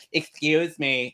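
high, slow imitation of someone squeezing past; drawn-out, high-voiced mock politeness; put-on polite request. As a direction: mimic an overly polite passer-by. Imitating people asking to get past.

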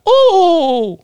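very high cartoon "ooh"; Mario. Host shows the weird game noises, high falsetto whoop. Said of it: "fucking weird ass fucking noises".

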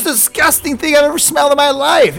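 high, appalled complaint; grossed-out outrage. Acted. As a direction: complain as if overwhelmed by stench. Host revolted by the smell.

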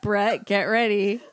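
mock warning through real laughter; laughing. Real, not acted. Co-host warning Brett about the accents, laughing. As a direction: announce it while still cracking up.

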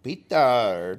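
loud, hammed-up Croatian name; mock Croatian accent. Co-host echoing a player's name, mock Croatian accent. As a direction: belt it out in a put-on Croatian accent. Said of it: "what's that accent?".

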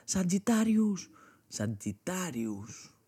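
drawn-out cartoon summoning call, high then dropping; imitating cartoon battle cry. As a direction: stretch the name like a mock anime hero. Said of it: "και φώναζαν αυτοί και έλεγε".

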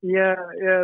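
ordinary talk

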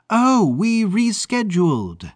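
high, brassy put-on agent voice; Maud mimicking a Hollywood agent. Maud fakes an agent to Fitzgerald at the gate. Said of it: "her best impression of Elizabeth's impression of a Hollywood agent".

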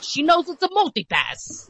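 high, exasperated mock insistence; insist, fed up, it's a multipass; Bruce Willis quote. Retelling the airport scene, exasperated high mock whine. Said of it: "then he's like".

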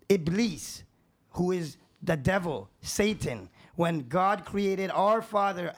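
ordinary talk, nothing marked

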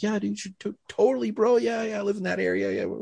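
high, eager placating voice; nervous agreeing. Retold or imitated. Cop recalling the ex-con's invite, high nervous placating. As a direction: agree too fast to keep him happy. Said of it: "I was scared".

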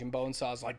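ordinary talk, nothing marked

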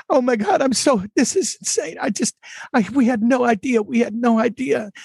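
retold mother's teary disbelief; imitating tearful mother. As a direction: echo a mother overcome with tears, lightly. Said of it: "she starts crying".

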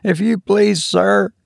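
a nervous ship's steward pleading with a passenger; high faltering steward. Acted. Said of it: "faltered Robert".

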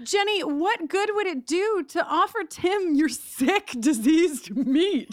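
hammed-up reading of the note; mock dramatic reading. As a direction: read it like a melodramatic letter. Reading a character's letter aloud.

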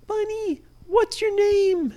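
put-on high, slow curious child; small children calling up from a hole; high kid voice. Kids in the hole ask the bunny his name, high and curious.

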